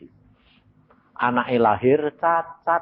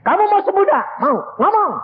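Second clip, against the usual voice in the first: suara jauh lebih tinggi dan keras; teriakan perintah; 4 dB louder than usual. Retold or imitated. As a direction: bentak dia supaya mau. Preacher orders the sick man. (berteriak memerintah si sakit)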